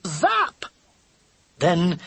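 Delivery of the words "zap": sharp high zap like a gunslinger; gunfighter cry. Acted. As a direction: fire it off with glee. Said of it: "with a cry of".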